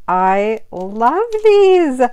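ordinary talk, nothing marked

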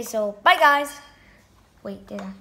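normal talking voice

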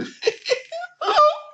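very high, squealing mock laugh; dumb laugh. Mocking someone making fun of people, dumb high laugh. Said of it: "Dumb laugh".

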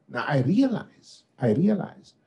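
ordinary talk

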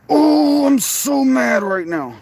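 slow, exasperated outburst, the "oh" high; real frustration. Real, not acted. Streamer venting to his viewers after the guard, high "oh" then slow fuming. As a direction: let it burst out, then drag the rest.

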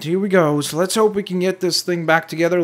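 ordinary delivery

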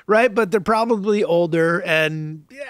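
ordinary talk

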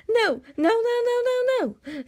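high, fast squeaky dragon crying no; a tiny dragon wailing in protest, rattling it off; frantic little dragon. Acted. Toothless begging Hiccup not to go, high squeaky wail.

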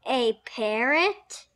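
loud, slow, soaring rise; astonished question. A narrator's reading. Class seeing Polly, loudly astonished question. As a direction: stretch it out in loud disbelief.

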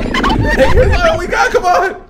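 high shrieking laughter while fleeing; panicked laughing screams. Real, not acted.

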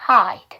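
hide drawn out, ai glide and a light d-eu ending; silent E. Shown as a demonstration. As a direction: say hide slowly, no e sound at the end. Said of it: "그래서 음호음".

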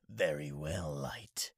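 low unvoiced creepy whisper; sinister whisper. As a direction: hiss it like a death god. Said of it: "anime impression video".

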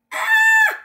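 sudden high loud scream of fright. Acted. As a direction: shriek at the flying saucer. Flying saucer scare in story.

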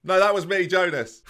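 high, loud interjection; shouting player. Acted. Jonas to the boss, shouting. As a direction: butt in with a yell. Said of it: "So is that you shouting?".

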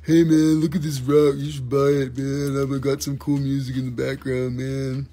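lightly put-on laid-back dude voice; a hint of a stoned surfer seller; mocking other sellers. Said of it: "Everybody else is like".

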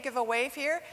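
ordinary talk, nothing marked

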